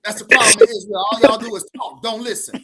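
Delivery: play-scold the ladies in the back; mock scolding shout. Pretend pastor telling the chattering ladies to hush, loud and high.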